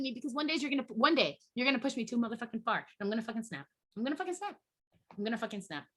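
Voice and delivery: soft, seething warning; sudden quiet after the outburst; hushed threat. Real, not acted. Rant drops into hushed threat.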